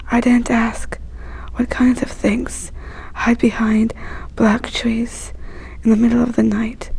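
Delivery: usual speaking voice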